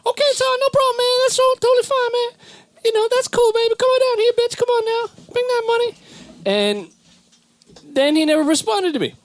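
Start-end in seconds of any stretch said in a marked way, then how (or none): 0.1-5.9 much higher, slick pimp voice; high hammed-up pimp impression; Oakland pimp voice. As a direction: play a smooth Oakland pimp, pitched way up. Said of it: "it's always like an Oakland pimp".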